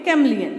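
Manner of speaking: Chameleon said as "KAM-lee-un"; three syllables in Chameleon, falling end. Shown as a demonstration. Teacher answering her pronunciation question, Chameleon as kam-lee-un. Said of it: "इसको हम क्या pronounce करेंगे?".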